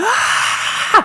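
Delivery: high-pitched strained frustrated "aah"; frustrated scream. Retold or imitated. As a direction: re-enact a scream of frustration, high but not full volume. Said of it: "let out this, like, frustrated scream".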